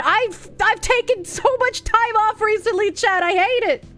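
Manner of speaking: high, whining to the chat; whiny complaint. Real, not acted. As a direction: whine it in frustration.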